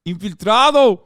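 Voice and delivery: loud, high Infiltrado! rolled out Portuguese-style; mock Brazilian shout. Streamer mocking protesters, loud Portuguese-style shout. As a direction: bark Infiltrado! like an angry protester. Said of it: "trying to do a Braz".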